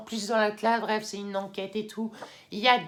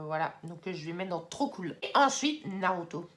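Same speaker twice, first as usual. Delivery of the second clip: ordinary talk, nothing marked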